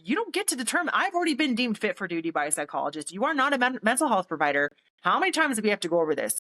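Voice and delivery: high-pitched, rapid protest; retold argument, pitch leaping on the key words; indignant. Argues back at the colonel.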